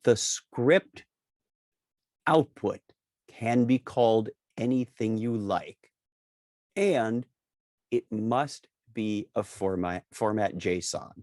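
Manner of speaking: spaced out with long pauses; slow and deliberate. Real, not acted. Teacher flagging a key naming rule, slow and deliberate. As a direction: say it slowly, piece by piece. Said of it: "I'll say this slowly".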